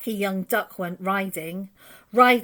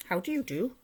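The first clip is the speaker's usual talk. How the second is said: a softer, lightly put-on duck greeting; polite duck; 3 semitones higher, 5 dB quieter, faster than usual. A narrator's reading. Duck greets the rat, soft polite voice. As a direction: greet him sweetly and politely. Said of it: "stopped with a".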